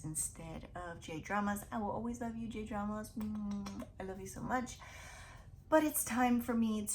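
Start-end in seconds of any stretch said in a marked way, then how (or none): none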